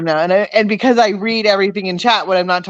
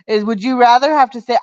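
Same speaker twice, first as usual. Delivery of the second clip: usual speaking voice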